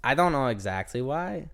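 usual speaking voice

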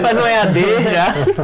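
amused mock complaint; laughing banter. Real, not acted. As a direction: tease through a laugh. Joking about gurps courses.

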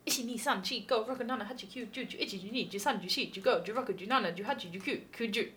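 quick, rote count to twenty in Japanese. Shown as a demonstration. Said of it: "Go and speak to twenty in Japanese".

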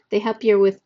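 ordinary delivery

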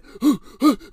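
loud, high theatrical gasp; mock gasp. Mocking the coworker's hissy fit with a loud gasp. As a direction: gasp like a tantrum starting. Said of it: "that's literally what he's doing".

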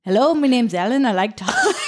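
badly faked British accent, breaking into laughter; attempted British accent. Host redoes the profile voice note for her doubting co-host, shaky British accent. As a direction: have another go at a mock British accent. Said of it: "Is that not British?".

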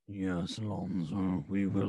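a touch of a looming villain dad; LeVar Ball voice. Retold or imitated. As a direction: boom it out low and dark. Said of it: "LeVar Ball voice".

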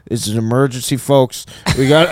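a doom-laden captain over the intercom; low pilot voice. Retold or imitated. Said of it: "Whoever was speaking was low".

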